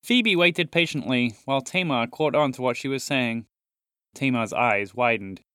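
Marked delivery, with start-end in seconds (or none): none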